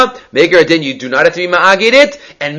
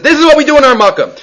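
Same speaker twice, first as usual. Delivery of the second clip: high, insistent mock shout; retold scream; 8 semitones higher, faster than usual. Scribe in the dream defending himself, mock screaming. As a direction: argue back in a pitched-up voice. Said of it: "they start screaming".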